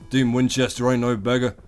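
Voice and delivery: ordinary talk, nothing marked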